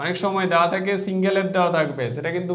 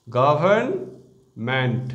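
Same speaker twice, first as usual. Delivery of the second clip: Government split slowly into syllables, গভ-র্ন-মেন্ট; broken into syllables; 5 semitones lower, much slower than usual. Shown as a demonstration. Teacher showing students how to split the word, slow syllables. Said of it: "এভাবে syllable ভেঙেও".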